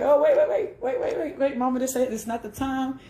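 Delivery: high, laughing plea to stop; frantic stopping. Retold or imitated. Calming mama down at the funeral, high and laughing. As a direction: hurry to hold mama back, laughing. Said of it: "I'm like".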